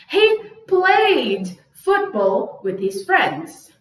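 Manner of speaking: very slow, stretched example sentence; slow model reading. Shown as a demonstration. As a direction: draw each word out for learners. Teacher reads example sentences slowly.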